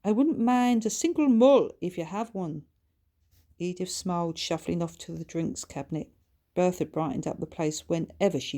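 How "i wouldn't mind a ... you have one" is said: higher, louder, animated character voice; spirited chat with an old friend; lightly voiced story character. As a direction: lift the voice into lively, teasing dialogue.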